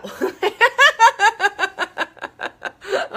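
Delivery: a high, loud burst of laughter; laughing at her own coffee joke; big laugh. Real, not acted. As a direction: crack up at your own joke. Laughs after joking about coffee.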